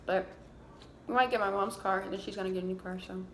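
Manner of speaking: chewing while talking; talking between bites; mouth full. Real, not acted. Seen 4: fry put in mouth at 0.4-0.9, chewing, then picking more.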